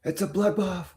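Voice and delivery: high panicked wail; hammed-up market panic; mock panic. Mock panic over market sell-off.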